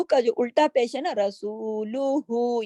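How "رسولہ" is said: رسولہ drawn out slowly, final ہ stopped; recited with pause. Shown as a demonstration. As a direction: stop on رسولہ, heavy را. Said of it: "یہ ہم نے پاز کر دیا".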